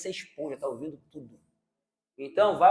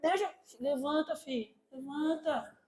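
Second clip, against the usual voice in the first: gentle coaxing; 6 semitones higher, 7 dB quieter, slower than usual. Acted. Gentle parent coaxing child.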